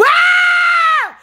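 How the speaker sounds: piercing high loud scream; sudden scream. Real, not acted. Jolted by something inside, sudden scream. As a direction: let out a sharp shriek. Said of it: "This yelling and screaming".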